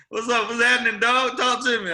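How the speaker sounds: high-pitched New Orleans greeting; New Orleans talk. Retold or imitated. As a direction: hail a buddy like a New Orleans local. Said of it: "You know how they talk".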